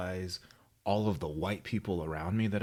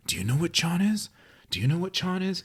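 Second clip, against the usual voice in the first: put-on high, louder little boy asking around; high confused kid; 10 semitones higher, 4 dB louder than usual. Boy asking his friends, high and puzzled. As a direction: pitch up like a bewildered kid. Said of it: "he goes".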